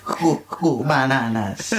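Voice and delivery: slow, warped reversed sound; backwards speech. Shown as a demonstration. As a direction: drag it out like reversed tape. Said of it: "flip all three of ours forwards again".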